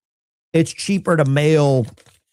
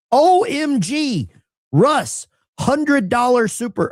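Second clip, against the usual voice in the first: excited; 11 semitones higher, 3 dB louder, slower than usual. Real, not acted. Big donation arrives, overjoyed.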